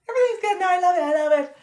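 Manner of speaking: high, loud, forced-cheerful mock voice; mock people pleaser. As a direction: parody someone insisting all is fine.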